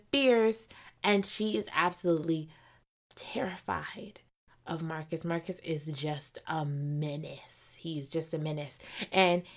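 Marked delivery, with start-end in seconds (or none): 3.2-8.8 whisper. Real, not acted. Whispering about the villain.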